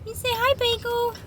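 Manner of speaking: very high sweet puppy talk. Real, not acted. Talking to new puppy.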